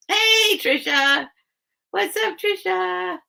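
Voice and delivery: high, drawn-out hello to a friend; singing out hello to a newcomer; excited greeting. Real, not acted. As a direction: call out a delighted welcome, stretching the name. Greeting a viewer who just arrived.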